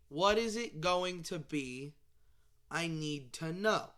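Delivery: "What is it going to be" spelled out slowly; slow, emphatic demand. Acted. Fan demanding the sequel's title, slow emphatic demand. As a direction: say "going to be" in full, slowly and deliberately.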